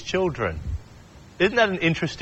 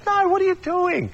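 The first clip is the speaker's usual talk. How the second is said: much higher, exasperated cry of disbelief; cranky. Retold or imitated. Jesus scolding his disciples, high and cranky. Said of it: "he gets cranky with them".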